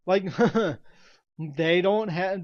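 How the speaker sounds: ordinary delivery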